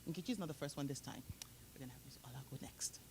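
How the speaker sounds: quick, breathy whispered aside. Real, not acted. Host whispering to the audience that Ola speaks next, whispered. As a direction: lean in and whisper the change of plan. Aside before handing the floor over.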